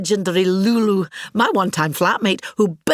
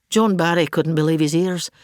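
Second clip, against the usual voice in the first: ordinary delivery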